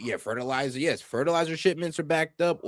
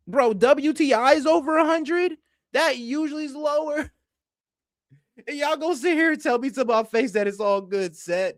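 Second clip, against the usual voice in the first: exasperated rant; 9 semitones higher than usual. Real, not acted. Ranting at viewers over prices.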